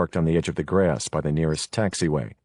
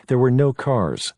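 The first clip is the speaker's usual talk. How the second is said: plain reading voice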